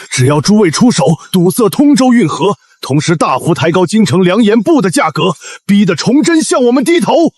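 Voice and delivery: rising, forceful rallying pitch; impassioned urging. Acted. Official rallies merchants against the emperor, impassioned and rising. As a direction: build to a high, insistent climax.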